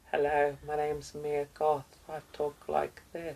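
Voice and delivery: soft, breathy-high little doll voice, quiet and slow; Victorian doll voice. Retold or imitated. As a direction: imitate a haunted Victorian doll, softly and slowly. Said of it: "her accent in real life is really strange 'cause she sounds like a possessed Victorian doll".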